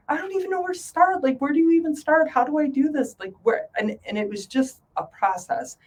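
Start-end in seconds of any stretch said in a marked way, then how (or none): 0.1-3.7 higher and fast, flustered; overwhelmed panic. Retold or imitated. Retelling not knowing where to start, flustered and high. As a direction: spill it out helplessly. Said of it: "I'm like".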